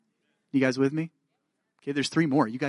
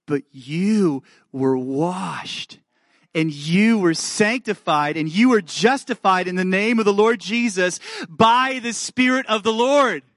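building proclamation; 3 semitones higher, 3 dB louder, slower than usual. Acted. Preacher proclaiming washed and justified to the congregation, triumphant build.

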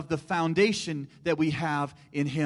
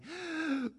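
gasp; 11 semitones higher, 8 dB quieter than usual. Retold or imitated. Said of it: "and I would say".

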